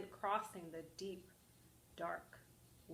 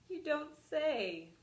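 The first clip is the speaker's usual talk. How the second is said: sly wolf; 6 semitones higher, slower than usual. Acted. Wolf scheming.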